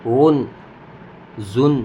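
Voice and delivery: ordinary delivery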